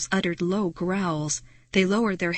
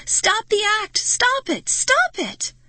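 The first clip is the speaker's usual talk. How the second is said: building higher and louder shriek; furious shrieking; 14 semitones higher, 5 dB louder, slower than usual. Acted. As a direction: shriek for them to stop the act. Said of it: "I shrieked".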